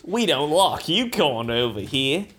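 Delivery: slow, broad Australian; unwelcoming put-on Australian; Australian accent. Australian character rejecting newcomers, slow Australian accent. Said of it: "I'll try to do an Australian accent".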